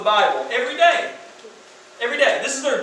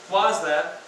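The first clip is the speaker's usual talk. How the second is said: ordinary talk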